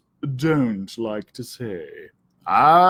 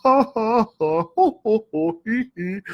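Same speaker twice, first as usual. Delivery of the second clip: taunting high cackle, ha ha ho ho hee hee; mocking laugh; 7 semitones higher than usual. Coyote brothers jeering at Pete, mocking cackle. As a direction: cackle at the cat.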